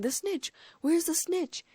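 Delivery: high, urgent shout; bellowed question. A narrator's reading. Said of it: "he bellowed".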